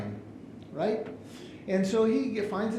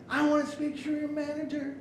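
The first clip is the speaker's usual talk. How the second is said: very high-pitched indignant demand; acted outrage, pitched way up; irate customer; 11 semitones higher than usual. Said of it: "He's yelling".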